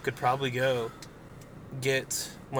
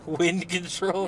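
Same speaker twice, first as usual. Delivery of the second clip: slow, drawn-out disbelieving "Wind control?"; incredulous; 4 semitones higher, much slower than usual. Real, not acted. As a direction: stretch it out in disbelief. Incredulous echo of a joke.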